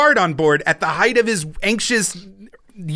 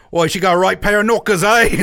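put-on leering British bloke accent, quick and louder; mock northern British lad voice; Ian Livingstone; 4 dB louder than usual. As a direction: do a cheeky British boss gloating. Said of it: "He's just like".

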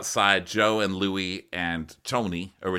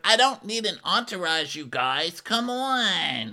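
high, exasperated; mock Gia; 10 semitones higher, slower than usual. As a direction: whine it up high. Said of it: "Gia's like".

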